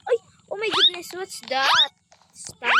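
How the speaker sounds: high, loud startled shout; startled cry. Real, not acted. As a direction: jolt up as something surprises you. Startled in game.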